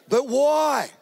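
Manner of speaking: high-pitched reluctant question, acted out; mock reluctance, voice lifted high; wary husband asking. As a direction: play the put-upon husband, pitched well up. Husband's acted reply to wife's request.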